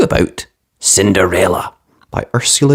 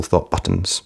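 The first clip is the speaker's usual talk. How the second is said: plain reading voice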